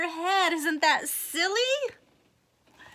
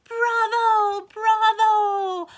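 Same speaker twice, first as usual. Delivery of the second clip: higher, louder cheering bravo; 8 semitones higher, 7 dB louder, much slower than usual. Acted. Teacher voices the cheering students, loud. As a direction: call it out like an applauding crowd.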